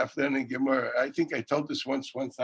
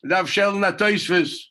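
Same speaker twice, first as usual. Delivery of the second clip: retold shout; 5 semitones higher, 6 dB louder than usual. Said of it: "But Sigarnish".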